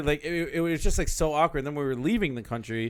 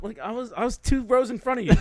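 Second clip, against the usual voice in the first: high, incredulous retort through laughter; mock outrage tipping into a laugh; laughing indignation; 10 semitones higher, 5 dB louder than usual. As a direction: protest in disbelief, cracking up. Retold indignation, breaking into laughter.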